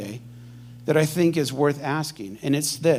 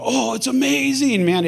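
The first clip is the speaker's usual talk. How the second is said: upbeat; 9 semitones higher than usual. Acted. Upbeat reply acted out.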